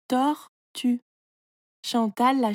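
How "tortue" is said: "Tortue" as tor, long pause, tue; "Tortue" in two separate beats; syllable by syllable. Shown as a demonstration. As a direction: break "Tortue" cleanly in two. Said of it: "tu coupes le mot en petites parties".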